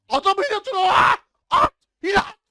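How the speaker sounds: high, loud alarmed yell; alarmed shout. Real, not acted. Gamer yelling at teammates to use a skill, alarmed shout. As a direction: shout the warning in a sudden panic.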